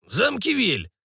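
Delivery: high-voiced old man answering drily; mildly dry, matter-of-fact answer; dry reply. A narrator's reading. Old man answering the waking patient, drily. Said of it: "сухо ответил старик".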